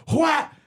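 high-pitched little "whap" yelp; imitated high yelp. Little girl falling on her face, imitated yelp. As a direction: yelp it far above your voice. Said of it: "her, her voice is".

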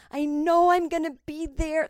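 high, loud re-enacted childhood thrill; freaking out. Little girl freaking out at home watching her idol, overexcited. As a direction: imitate your overexcited younger self. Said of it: "like, freaking out".

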